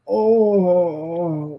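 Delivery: long drawn-out "oh" moan; mock moan of relief; imitated moan. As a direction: moan as if slipping into bliss. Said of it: "like, moaning".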